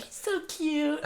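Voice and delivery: put-on high cooing baby talk; cooing dog owner. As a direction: coo it like a doting owner. Mimicking owners cooing over pug.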